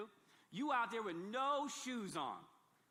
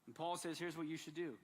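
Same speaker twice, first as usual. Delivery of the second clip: normal talking voice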